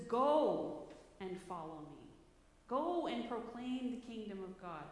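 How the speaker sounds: "Go" slow and loud, hit hard; stress on Go. Shown as a demonstration. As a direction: lean slowly into "Go" both times. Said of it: "He says".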